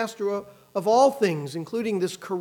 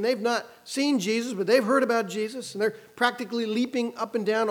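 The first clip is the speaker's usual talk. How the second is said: ordinary talk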